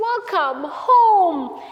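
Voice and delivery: loud, high, long-drawn greeting; joyful welcome. Acted. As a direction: call it out, stretching each word. Father welcomes his son back.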